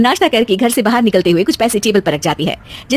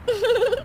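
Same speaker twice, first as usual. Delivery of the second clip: laugh; 13 semitones higher, 7 dB quieter than usual. Acted. Closing laugh.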